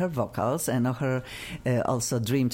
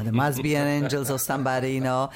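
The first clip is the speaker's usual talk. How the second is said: ordinary talk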